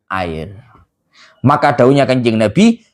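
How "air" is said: dramatic whisper. Acted. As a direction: whisper "air" as the quiet payoff. Preacher drops to punchline.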